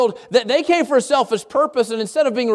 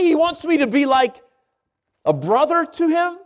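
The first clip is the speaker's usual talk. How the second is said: high, disbelieving wonder with pauses; shocked amazement; 6 semitones higher than usual. Acted. Healed people sitting at his feet, amazed they are wanted as family. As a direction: act out stunned, questioning amazement. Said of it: "the great shock would be".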